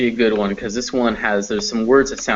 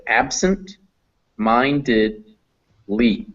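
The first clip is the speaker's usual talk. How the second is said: "absent", "minded", "Lee" split by long pauses; teacher sounding out "absent", "minded", "Lee" separately; broken into chunks; much slower than usual. Shown as a demonstration. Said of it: "let's try pronouncing in some chunks".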